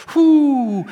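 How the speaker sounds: high loud whoo of alarm; mock startled exclamation. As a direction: let out a shocked whoo. Reaction to bold evangelism.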